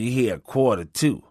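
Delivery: ordinary delivery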